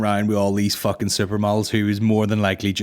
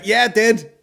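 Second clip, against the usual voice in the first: high, brazen admission; Donald Trump; 11 semitones higher, 3 dB louder than usual. Acted. Comic voicing a president owning up, brazen high voice. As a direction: own it loudly and shamelessly. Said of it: "he'll just own it".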